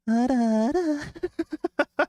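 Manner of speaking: put-on shrill sing-song old crone; a witch humming gleefully; hag voice. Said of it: "Oh, well, hag voice".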